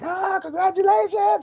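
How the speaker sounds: very high, slow mock cheer; hammed-up crowd congratulations. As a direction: call out like well-wishers. People cheering on the pier.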